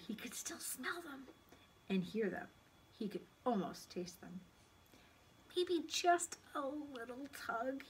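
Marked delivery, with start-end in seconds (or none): 5.5-7.8 slow, higher tempted thought; wistful little inner voice; tempted musing. A narrator's reading. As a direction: toy with a naughty idea, drawn out. Paul's tempted thought, story.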